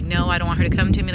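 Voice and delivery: loud, rushed plea; acted-out desperate refusal, fast and loud; pleading. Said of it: "like, pleading".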